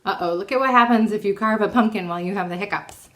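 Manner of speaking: usual speaking voice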